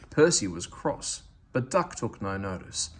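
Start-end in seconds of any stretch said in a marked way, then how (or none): none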